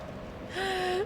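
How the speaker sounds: sharp high gasp of dismay; a real gasp as the friend dies; shocked gasp. Real, not acted. Streamer gasping as her NPC ally dies, shocked gasp.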